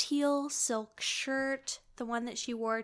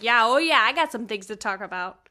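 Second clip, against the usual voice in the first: mock pointed sarcasm; 9 dB louder than usual. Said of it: "Davy's like".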